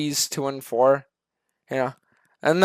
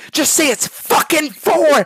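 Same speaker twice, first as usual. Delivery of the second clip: higher, louder, slow furious demand; louder angry yell; 11 semitones higher, 5 dB louder, slower than usual. Acted. Streamer losing patience with a teammate, in an angry yell. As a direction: snap and yell the answer at him.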